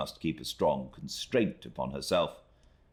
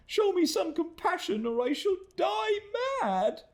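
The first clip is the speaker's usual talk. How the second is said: crying out for mercy. Acted. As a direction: cry out begging for mercy. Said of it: "cried Emily".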